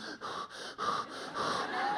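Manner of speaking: exaggerated anxious exhale; exasperated huffing. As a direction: huff out the stress. Acting out stress over driving.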